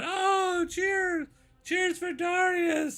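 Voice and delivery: very high, drawn-out cheer; cheering boy. Acted. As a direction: cheer the hero in a squealed child voice. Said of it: "they, they both cheer on".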